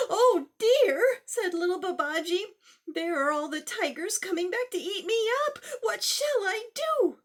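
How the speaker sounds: put-on high, fast frightened child; panicked. Babaji panicking as the tigers return, high and fast. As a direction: panic as tigers come back.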